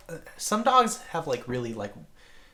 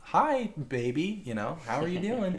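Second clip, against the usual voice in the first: put-on sweet baby greeting; 6 semitones higher than usual. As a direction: coo gently at the infant.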